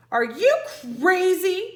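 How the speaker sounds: high, loud alarmed call. Acted. Jack calling to Annie climbing down, loud and alarmed. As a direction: call after her in alarm. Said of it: "Jack called".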